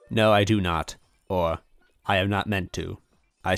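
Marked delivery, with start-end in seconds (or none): none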